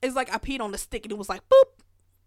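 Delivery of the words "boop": a sudden high, loud little beep; imitated beep. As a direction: squeak it out like the digital test popping up. Imitating the test beeping instantly.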